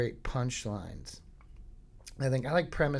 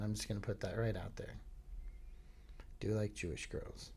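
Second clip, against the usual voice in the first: sheepish aside; 4 semitones lower, 11 dB quieter than usual. Real, not acted. Confessing aside.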